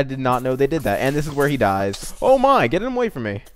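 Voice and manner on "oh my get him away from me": panicked shriek. Real, not acted. Creeper explodes near her.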